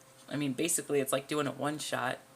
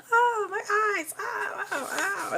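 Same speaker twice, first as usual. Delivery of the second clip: very high, loud cartoon yelps of pain; hammed-up high ow ow ow; 4 dB louder, slower than usual. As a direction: yelp like pepper spray in the eyes. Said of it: "he's freaking out".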